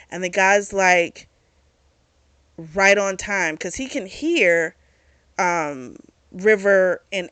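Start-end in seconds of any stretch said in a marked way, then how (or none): none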